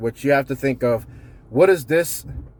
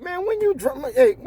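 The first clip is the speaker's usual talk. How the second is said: high, scoffing jeer; mock the boss's drunk night; mocking team; 12 semitones higher than usual. Team mocking the boss after a drunken night. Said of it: "They all looking at you like".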